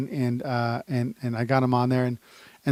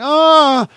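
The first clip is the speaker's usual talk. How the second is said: loud, high cry of "ah"; panicked yell; 13 semitones higher, 10 dB louder than usual. Acted. Driver frantic to lock the door, crying out. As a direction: yelp while fumbling to get away.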